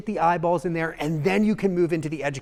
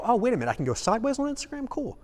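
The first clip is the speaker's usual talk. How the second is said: quick, higher, pleasantly surprised; mock surprise; 7 semitones higher, 3 dB quieter, faster than usual. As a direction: act out a viewer who just discovered something. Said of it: "the ability to go".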